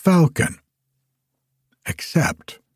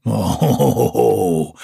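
fierce giant; 3 semitones lower than usual. A narrator's reading. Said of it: "quoth the giant fiercely".

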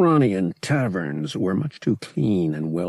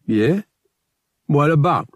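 a lightly voiced suspicious street dealer, raised and guarded; wary dealer; 7 semitones higher, 7 dB louder than usual. Dealer to Han in dim hallway, wary and guarded. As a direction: squint at him and ask warily.

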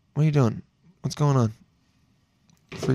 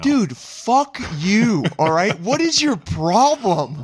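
high, drawn-out comic indignation; mock outrage; 6 semitones higher, slower than usual. Scolding friend over his confession, mock outraged. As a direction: stretch each word in exasperated disbelief.

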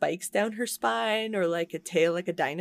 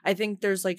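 normal talking voice

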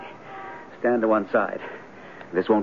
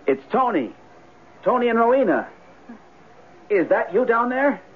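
calling out; 10 semitones higher than usual. Acted. Calling down into the basement.

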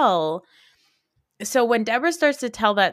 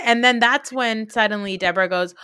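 ordinary talk

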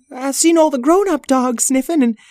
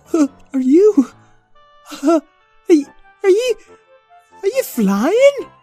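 stammering amazement; 7 dB louder than usual. Acted. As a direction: stumble over it, wide-eyed. Puppy amazed at flying dog.

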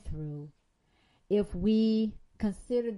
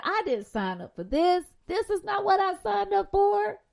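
complaining quote; 9 semitones higher, 6 dB louder than usual. Retold or imitated. Voicing a complaining believer.

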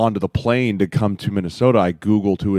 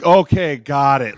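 flustered acknowledgement; 5 semitones higher, 8 dB louder than usual. Retold or imitated. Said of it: "I was like".

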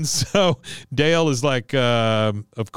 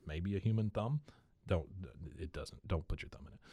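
normal talking voice

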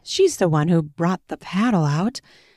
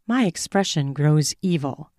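usual speaking voice

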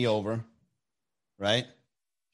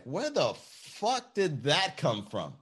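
slow, stretched-out disbelief; acted bewilderment at an outburst; bewildered; 5 semitones higher, 3 dB quieter, slower than usual. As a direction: draw it out, higher, as if baffled. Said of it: "yelling tirade where it was like".

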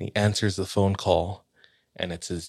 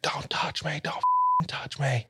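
a retold threat, pitched much higher and softer; menacing warning to keep away, imitated; a panicked soldier threatening his squad, hushed and strained; 9 semitones higher, 6 dB quieter than usual. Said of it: "he starts threatening them".

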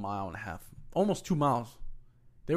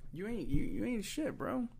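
pitched way up, taunting; high mocking insult; 11 semitones higher than usual. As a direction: sneer it high and scornful. Retold insult to coworker.

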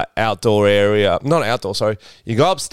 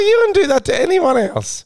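mock indignant. Retelling fan encounter, high voice.

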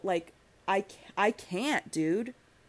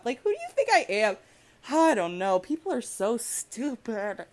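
getting emotional; 3 semitones higher, 3 dB louder than usual. Real, not acted. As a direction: let frustration boil over, dragging out the last words. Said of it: "Sorry, I'm getting emotional".

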